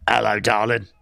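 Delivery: low, cheeky put-on greeting; James impression. Streamer imitating James in the chat, low put-on voice. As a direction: drop the pitch for a chat-up line. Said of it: "That's my James impression".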